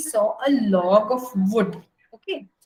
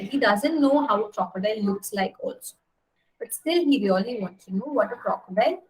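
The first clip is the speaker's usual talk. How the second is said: ordinary delivery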